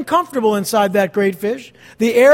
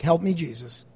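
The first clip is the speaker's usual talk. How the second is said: soft prayer; 6 semitones lower, 7 dB quieter than usual. Acted. A model prayer spoken.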